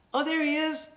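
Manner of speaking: pleased discovery. Shown as a demonstration. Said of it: "I hear my cat and I look".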